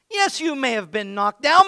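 usual speaking voice

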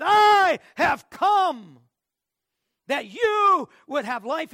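slow, loud, ringing proclamation; proclaiming. Acted. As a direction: cry it out like a herald. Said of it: "Jesus said".

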